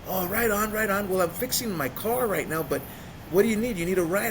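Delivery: high, energetic buddy patter; imitated hyped friend. Friend offers a ride from the Greyhound, excited. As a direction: play the over-friendly pal on the phone.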